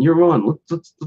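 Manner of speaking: mild mock trash talk; cocky Jack. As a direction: brag like a fast-food mascot picking a fight. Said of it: "[name] keeps saying".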